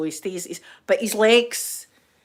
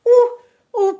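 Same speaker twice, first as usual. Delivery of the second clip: high excited cry; 10 dB louder than usual. Real, not acted. Swooning over Jason's bottom.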